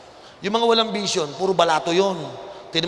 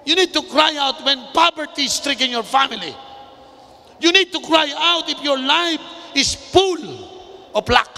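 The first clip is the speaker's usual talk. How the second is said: raised, driving pulpit voice, slowing on key words; fiery preaching; 6 semitones higher than usual. Acted. Pastor urging the congregation to shout to God, fiery preaching. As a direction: preach it hard, calling them to cry out.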